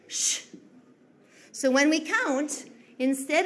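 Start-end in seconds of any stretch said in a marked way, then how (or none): 0.1-0.5 soft voiceless shh; one quiet hush; shushing. Shown as a demonstration. Teacher showing the class what the shh sound is like. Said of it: "This sound looks like this".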